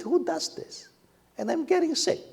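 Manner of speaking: high, put-upon grumble; indignant complaint. Retold or imitated. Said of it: "I was just muttering like that".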